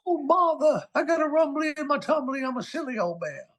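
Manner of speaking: a cocky Winnie the Pooh impression. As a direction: do the bear gently to show off. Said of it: "I do a killer Winnie the Pooh".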